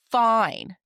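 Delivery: mock a teenager's dismissive fine; sulky fine. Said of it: "in that tone".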